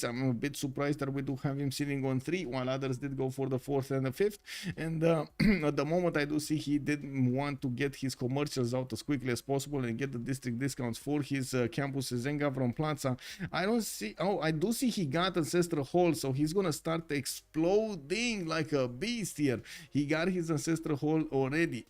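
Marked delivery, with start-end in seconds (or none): none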